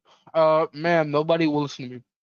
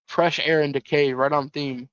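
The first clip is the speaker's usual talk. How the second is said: ordinary talk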